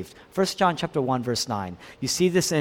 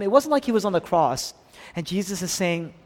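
usual speaking voice

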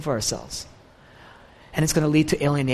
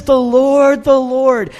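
loud, higher proclamation of the Lord; emotional proclamation; 8 semitones higher, 10 dB louder, much slower than usual. Retold or imitated. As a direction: cry it out with full emotion. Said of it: "He's emotional about it".

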